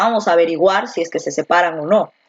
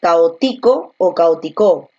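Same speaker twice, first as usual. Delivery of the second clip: caótico on TI, then caótico on CO; shifting wrong stress; 3 semitones lower, slower than usual. Shown as a demonstration. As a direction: move the stress later each time: caótico on TI, then on CO. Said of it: "tú dices".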